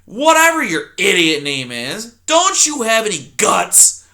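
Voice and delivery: put-on loud, grumbling old porcupine; loud cranky old porcupine; grumpy old porcupine. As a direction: snap at the intruder like a cranky old coot. Erith voiced in story.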